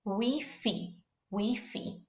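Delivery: "Wi-Fi" said as "wee-fee"; Spanish pronunciation. Shown as a demonstration. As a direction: give Wi-Fi two Spanish ee vowels. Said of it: "our example word".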